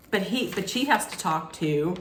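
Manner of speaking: ordinary delivery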